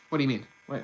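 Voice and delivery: normal talking voice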